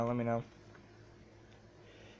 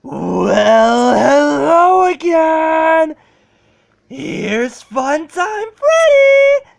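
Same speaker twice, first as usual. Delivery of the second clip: character voice; 20 dB louder, much slower than usual. Retold or imitated. Imitates the game character's greeting.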